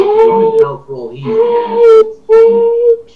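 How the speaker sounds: high squeaky mocking voice of the creator. Sprite chat, mocking the creator's hatred in a high squeak. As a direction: squeak it out like a cartoon.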